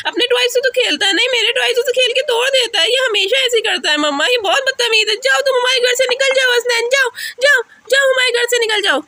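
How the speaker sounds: put-on high, whining child complaint; aggrieved childish voice; upset little girl. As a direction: tattle to mum in a child's pitch. Child rants about brother.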